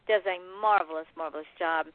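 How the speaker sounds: normal talking voice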